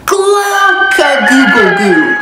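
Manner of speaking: slow, loud drawn-out crow; silly rooster crow. Shown as a demonstration. Leading the children to crow together, slow and loud. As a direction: lead the kids in a big slow crow. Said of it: "Can you guys say that one?".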